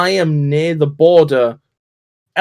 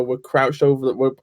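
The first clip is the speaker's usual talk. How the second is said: usual speaking voice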